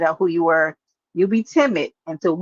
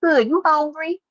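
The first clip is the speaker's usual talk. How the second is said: high, coaxing mock-sweet voice; teasing you hungry; 8 semitones higher, slower than usual. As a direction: coax him to eat like a fussing mom. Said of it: "I'ma be like".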